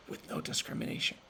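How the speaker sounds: hushed emphasis on "no discrimination"; emphatic whisper. Real, not acted. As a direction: drop to a whisper to drive it home. Repeats key mission phrase.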